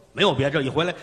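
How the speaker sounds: usual speaking voice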